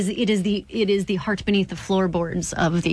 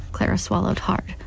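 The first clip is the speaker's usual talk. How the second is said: plain reading voice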